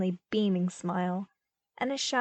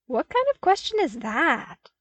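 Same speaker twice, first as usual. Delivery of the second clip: higher, louder, incredulous and slowed at the end; baffled disbelief; 8 semitones higher, 4 dB louder than usual. A narrator's reading. Luke baffled that the clones would wait outside, high and incredulous. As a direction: treat the question as absurd. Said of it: "stared at the man in bafflement".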